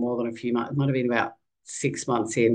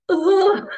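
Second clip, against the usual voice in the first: much higher, louder mock groan of embarrassment; embarrassed groan; 7 dB louder than usual. Husband cringing at wife's rudeness, high embarrassed groan. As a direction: cringe out loud at your wife. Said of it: "my poor husband was like".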